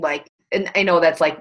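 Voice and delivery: ordinary talk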